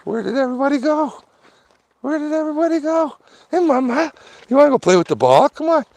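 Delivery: very high, loud doggy talk. Real, not acted. He greets his dog at home in high, loud baby talk.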